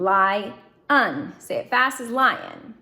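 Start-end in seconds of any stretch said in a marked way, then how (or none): 0.0-1.3 Lie un said as two separate parts with a short gap; syllables split. Shown as a demonstration. Teacher splitting lion into parts for students, sounded out. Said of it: "This is where that schwa comes in".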